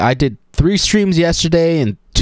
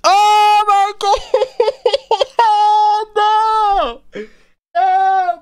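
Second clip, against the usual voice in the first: high, stretched-out cry of disbelief; shocked excitement; much slower than usual. Real, not acted. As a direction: gasp out the shock, drawing it out. Shocked by the game scene.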